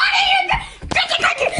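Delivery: laughing shriek; shouting. Real, not acted. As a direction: scream it while laughing.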